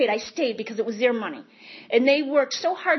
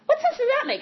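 much higher, indignant; indignant disbelief; 13 semitones higher than usual. Retold or imitated. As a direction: shoot the question up in outrage. Outraged at prison threat.

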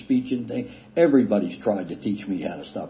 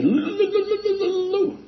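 stuttered name, much higher and louder; stuttering call; 3 dB louder than usual. Retold or imitated. Man stuttering to get his sponsor's attention, high stammer. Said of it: "I told you about my stutter".

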